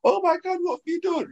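high retold gasp of horror; shocked trainer. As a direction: trainer seeing the iced knee, mock horrified. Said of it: "she was like".

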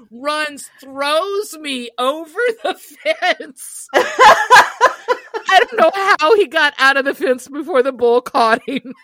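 slow, high, laughing delivery. Real, not acted. Recalling the dad's rescue to the host, laughing. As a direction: let the laughter break into the words. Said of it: "laughing through story".

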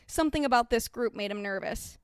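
ordinary delivery, nothing marked

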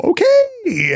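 very high, tiny squeak of an okay; meek little okay. Retold or imitated. Boyfriend meekly answering girlfriend, tiny high squeak. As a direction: squeak it out, deflated. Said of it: "his little".

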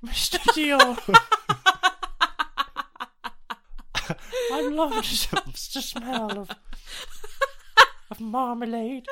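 put-on plummy British accent, giggling, very high; mock posh British accent. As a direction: swoon over marmalade like a fake posh Brit. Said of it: "That's what Robert Pattinson sounds like".

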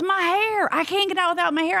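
high-pitched retold fretting; mock distressed protest. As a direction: act out her protest in a high voice. Acted protest over lost hair.

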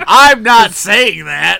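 loud, high laughing denial; loud protest. Real, not acted. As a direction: yell the denial playfully. Teasing denial of the line.

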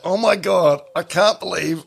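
high squealing gloat, laughing; gloating young self. Retold or imitated. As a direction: gush over the win like a giddy teen. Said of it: "you were something like".

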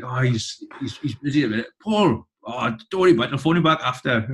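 breezy mock Valleys accent, brushing it off; Welsh Valleys accent. Dad tells the band the wrestler is busy, in a Valleys accent. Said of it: "Welsh accent or, you know, a Valleys accent".

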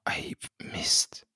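under the breath, voiceless; whispered curse. Real, not acted. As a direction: hiss a curse to yourself. Whispered curse at own mistake.

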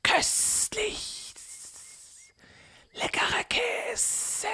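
slow, high whispered serpent; hissing snake whisper. Acted. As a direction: hiss it with greedy delight. Snake eats the cheese.

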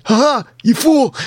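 high, punchy mock-villain taunt; mock gloating. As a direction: crow over the fooled victim. Game master mocks blinded player.

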